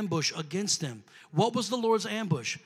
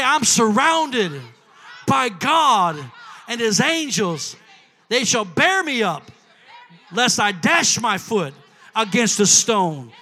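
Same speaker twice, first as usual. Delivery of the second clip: congregation call-back; 8 dB louder, slower than usual. Acted. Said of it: "Everybody say".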